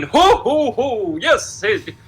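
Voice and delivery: high, hammed-up cartoon snowman. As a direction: slip back into the goofy high mascot voice. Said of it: "Imagine I used this voice for all of that".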